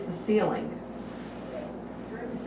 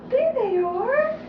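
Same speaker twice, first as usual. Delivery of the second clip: high delighted reveal; light up with joy; 13 semitones higher, slower than usual. Shown as a demonstration.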